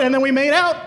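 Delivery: triumphant shout. Real, not acted.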